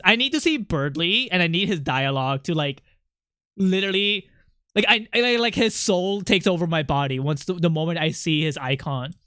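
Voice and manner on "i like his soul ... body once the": usual speaking voice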